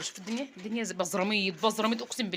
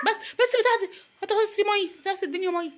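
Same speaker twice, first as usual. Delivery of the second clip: exasperated scolding; 12 semitones higher, 7 dB louder than usual. Real, not acted. Mother scolding child cleaning floor.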